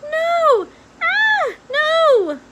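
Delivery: high loud cries of fright; screaming. A narrator's reading. Princess shrieking at the rat, high frightened cries. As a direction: shriek no in terror.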